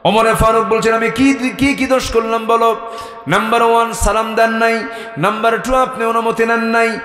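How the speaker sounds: high, loud chanted list; full-force preaching. A narrator's reading. Woman listing Omar's faults, high loud preacher's chant.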